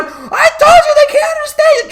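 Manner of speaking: high, loud mock kid shout; frantic kid. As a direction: shriek it like a fed-up child. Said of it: "kid answering cop".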